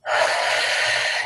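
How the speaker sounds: long sighing exhale; deep breath. Shown as a demonstration. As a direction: show a slow, deep cleansing breath. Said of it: "then you take a deep breath".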